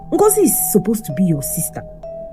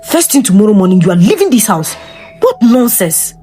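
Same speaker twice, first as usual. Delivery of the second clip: louder angry order to leave; angry shout; 3 semitones higher, 9 dB louder than usual. Acted. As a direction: throw her out with fury. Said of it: "Ikenna shouted".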